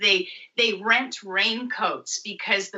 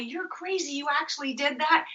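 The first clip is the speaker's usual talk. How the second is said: higher, soft teasing; mock scolding friends; 8 semitones higher, 4 dB quieter than usual. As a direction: tease her like friends would. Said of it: "people would tell me".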